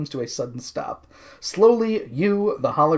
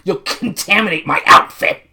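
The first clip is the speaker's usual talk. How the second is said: higher, louder haughty put-on voice; snooty outrage, pitched up; Pizzazz; 4 semitones higher, 6 dB louder than usual. Pizzazz scolding the guard, high loud indignant voice. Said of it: "Pizzazz tells the guard".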